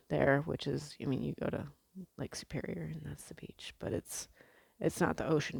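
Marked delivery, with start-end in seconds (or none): 2.2-4.3 whispered aside. Real, not acted. Aside comparing lake to ocean.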